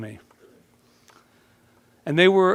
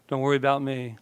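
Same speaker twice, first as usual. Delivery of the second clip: normal talking voice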